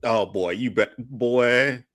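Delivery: usual speaking voice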